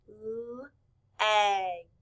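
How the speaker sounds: "l-egg" split into held l, short e, g; sounded out. Shown as a demonstration. Teacher showing class the letter sounds, sounded out. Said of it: "We hear".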